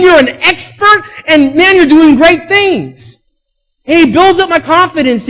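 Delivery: loud, high, preaching at full force. Acted. Pastor preaching to his church, full force. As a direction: preach it to the congregation.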